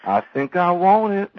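slow, high southern innocent; Dewey Cox, naive. Retold or imitated. Dewey gives in on the final line, slow naive drawl. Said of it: "like, very naive".

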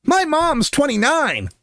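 slow, high-pitched child imitation; Mia. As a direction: chime in helpfully like a small kid. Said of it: "Mia helpfully interjects".

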